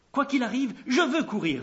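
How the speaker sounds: plus haut et plus fort, avec détermination; insiste, sans céder; insistance obstinée. A narrator's reading. Achimaatz insiste une dernière fois. (obstination ardente)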